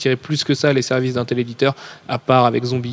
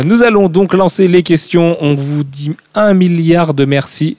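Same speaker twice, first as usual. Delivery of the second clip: deliberately slow; 4 semitones higher, slower than usual. Shown as a demonstration. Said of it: "Est-ce que je parle assez lentement".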